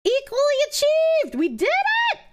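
high, far-off victory squeal; mock triumphant cheer. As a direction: cheer like a giddy fanatic.

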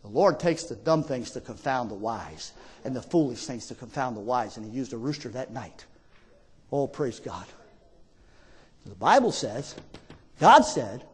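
very low, soft preaching; hushed reverence. Real, not acted. Preacher marvelling at God using a rooster, hushed reverence.

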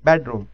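teacher modelling first-syllable stress; stress on BED. Shown as a demonstration. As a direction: punch BED in bedroom. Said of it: "first syllable पे".

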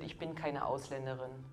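ordinary talk, nothing marked

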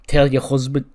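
loud first stab at Scouse; badly faked Scouse, loud; attempted Scouse accent. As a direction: have a rough go at a Scouse accent. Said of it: "I can't do my Scouse accent".

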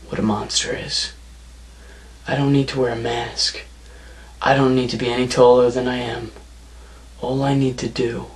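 ordinary delivery

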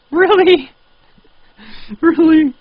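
high, loud disbelieving cry; shrieked disbelief; incredulous. Real, not acted. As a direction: squeal out the disbelief, laughing. Disbelief at game name.

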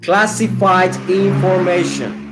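"Classified information" measured and clearly spaced; slow model. Shown as a demonstration. English teacher giving students a phrase to repeat, slow model. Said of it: "Listen and repeat".

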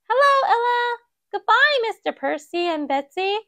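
high cheerful mouse voice; Maisie's voice. Acted. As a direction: greet a friend brightly as the little heroine. Maisie greets friend, says goodbye.

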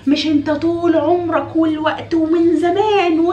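high, slow tearful whine of an accusing wife; dramatic crying wife. Acted. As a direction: sob it out as an overdramatic wife. Acted dramatic wife complaining.

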